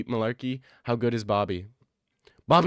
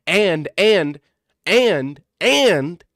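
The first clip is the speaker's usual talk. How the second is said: rising shout; 5 semitones higher, 7 dB louder, much slower than usual. Real, not acted. Stammering, building shout.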